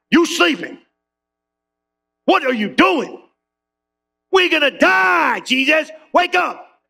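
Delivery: high, shouted plea to wake up; panicked plea. Acted. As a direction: yell it as the boat fills with water. Acted panic, shaking Jesus.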